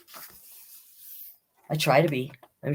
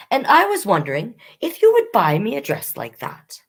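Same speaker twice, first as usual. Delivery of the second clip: put-on higher, hopeful little kid voice; eager child; 6 semitones higher, faster than usual. Bailey telling Mother the dream, higher eager child voice. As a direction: ask like a child hoping for a yes.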